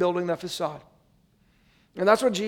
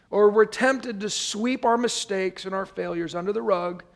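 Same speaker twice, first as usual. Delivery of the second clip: ordinary talk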